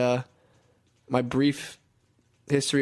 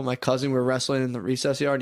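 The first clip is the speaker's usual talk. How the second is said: normal talking voice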